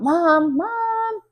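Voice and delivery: high, loud calling out; loud high call up the house; shout. A narrator's reading. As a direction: call downstairs to mom. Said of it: "shout out".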